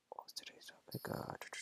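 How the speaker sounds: hushed aside, trying to remember; barely audible whisper; whispered to self. Real, not acted. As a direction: whisper it to yourself mid-intro. Host forgets topic, asks himself.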